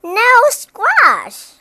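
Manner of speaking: very high, loud whoop of delight; big storybook exclamation; excited cry. A narrator's reading. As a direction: shout it out, thrilled. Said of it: "he cried".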